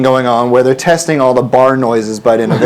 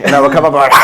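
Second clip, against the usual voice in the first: normal talking voice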